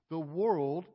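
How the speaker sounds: normal talking voice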